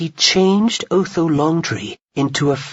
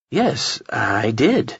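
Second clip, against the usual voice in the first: serious tone; 4 semitones lower, much slower than usual. A narrator's reading. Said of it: "said Alex in a serious tone".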